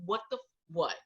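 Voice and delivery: stunned muttering. Retold or imitated. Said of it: "we're like".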